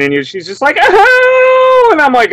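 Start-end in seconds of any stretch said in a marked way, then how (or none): 0.8-1.9 high, long mock wail; drawn-out put-on sob, pitched far up; imitated crying. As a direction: mimic her breaking into a cry. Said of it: "she starts crying".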